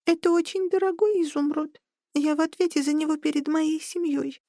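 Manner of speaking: tiny worried creature voice; thin high squeak, earnest; squeaky high voice. A narrator's reading. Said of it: "пропищал он тоненьким голоском".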